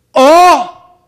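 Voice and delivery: high, loud startled cry; hammed-up prince's burst; sudden loud oh. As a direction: yell out of nowhere. Prince's outburst on terrace.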